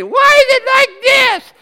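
high, slow whining complaint; mock discouraged whine. Preacher mimicking a discouraged believer, high whine. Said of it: "wanna be discouraged and say".

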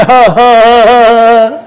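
long, pushed-out ha-ha laugh; demonstrated belly laugh. Shown as a demonstration. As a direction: grab the belly and let out a big mock laugh. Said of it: "start laughing".

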